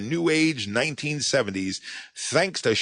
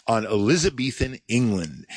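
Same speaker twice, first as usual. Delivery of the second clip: normal talking voice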